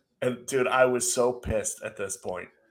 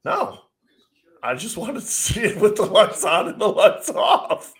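high, louder, laughing innocence; mock innocent; 9 semitones higher, 5 dB louder than usual. Answering the boss, a high laughing feigned-innocent reply. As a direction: act wide-eyed innocent, stifling a laugh. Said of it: "And I'm like".

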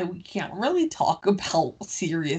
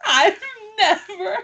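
laughing; 9 semitones higher than usual. Real, not acted. Laughing disbelief at new phrase.

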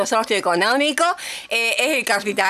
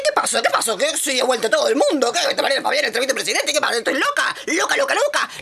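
retold shock; 4 semitones higher, faster than usual. Retelling her shocked reaction.

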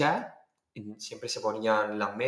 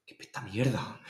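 hushed mock disgust; retold dancer's aside; 2 semitones lower, 8 dB quieter than usual. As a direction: say it softly under your breath. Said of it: "Me decía una".

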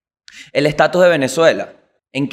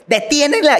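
mock shrill lady; 11 semitones higher than usual. Mocking the woman who shouted.

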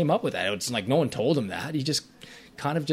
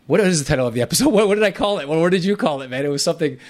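laughs while hunting for a title he forgot, pitch jumping high and louder; laughing at himself; 6 semitones higher, 7 dB louder, faster than usual. Real, not acted. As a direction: chuckle through the question, higher and louder. Searching for the episode title.

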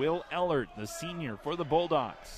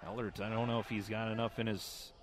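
ordinary talk, nothing marked

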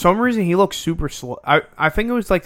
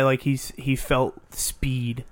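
slow drawl; 6 dB quieter, much slower than usual. Retold or imitated. Teammate retelling a drugged player.

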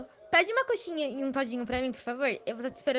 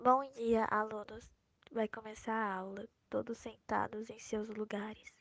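teacher voice; 2 semitones lower, 6 dB quieter, slower than usual. Acted. Professora falando com a turma.

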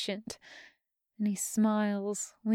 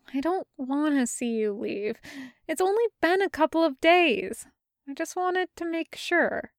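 higher worried Jester voice; lightly voiced anxious young woman; Jester's voice; 6 semitones higher than usual.

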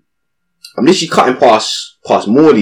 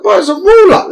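much higher, mock exasperated; retold annoyed reply; exasperated retort. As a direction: pitch it up indignant.

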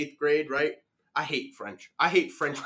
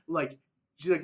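normal talking voice

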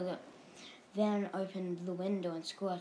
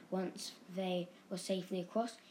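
usual speaking voice

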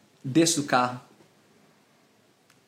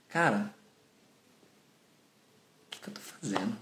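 low, much softer voice to himself; hushed self-reproach; 9 semitones lower, 11 dB quieter than usual. Retold or imitated. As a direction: sink into a quiet, dismayed realization. Acting out his moment of realization. (baixinho, caindo em si)

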